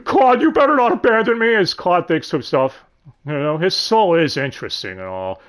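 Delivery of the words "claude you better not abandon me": high, loud, hammed-up demand; demanding outcry. [name] warns Claude not to abandon [name], crying out. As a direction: cry it out high, ordering him to stay.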